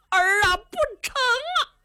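high, slow, tearful cry; old father crying out to his son not to sell the land; crying plea. A narrator's reading. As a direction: break into a wail begging him to stop.

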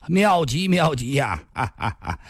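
high exclamation tailing off into chuckles; delighted laughing. A narrator's reading. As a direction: laugh in hearty approval. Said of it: "紫面少年抚掌笑道". (抚掌大笑着叫好)

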